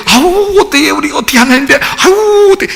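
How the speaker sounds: high-pitched, fussing mock dismay; hammed-up "oh no, how could I"; mock humility. Mimicking falsely humble listeners.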